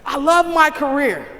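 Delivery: loud, high mock gushing; big, high-pitched professing of love; gushing declaration. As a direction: proclaim love in a showy, hammed-up way. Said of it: "We so often sin and say".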